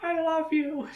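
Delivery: high and soft, slow farewell; a tender last goodbye; dying Char. Retold or imitated. Recapping the show, speaker voices dying Char thanking Kaylin. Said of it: "and it's like".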